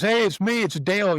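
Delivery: cheery hello. Retold or imitated. Quoting Dale's cheery message.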